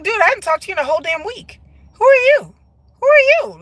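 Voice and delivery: high, rising to loud disbelief; mock indignant. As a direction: confront a near-stranger in playful outrage. Retells confronting a forgotten texter.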